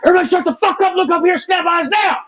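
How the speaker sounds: high, bellowing shout of a drill instructor; drill sergeant yell. Retold or imitated. Platoon sergeant yelling to silence the Marines, full shout. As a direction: bark the order at the whole company. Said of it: "just stands up and goes".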